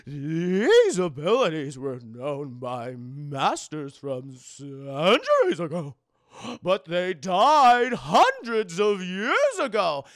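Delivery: slow, loud, drawn-out master's voice; old master. Retold or imitated. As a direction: intone it like an ancient master. Old master explains the spells.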